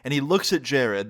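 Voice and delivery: ordinary talk, nothing marked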